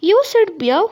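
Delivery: high, eager puppy accusing; excited puppy. Acted. Teacher voicing the puppy to the honeybee. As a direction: pipe it up like a little puppy.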